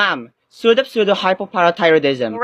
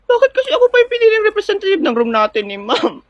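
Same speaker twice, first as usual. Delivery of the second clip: whiny half-cry. Acted. Chosen student whines about it.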